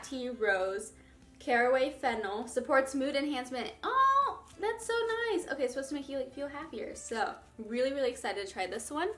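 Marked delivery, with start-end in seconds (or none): 3.8-5.5 high, bright oh of delight; pleasantly surprised by the label; delighted surprise. Real, not acted. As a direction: squeal of real delight. Delighted reading tea label.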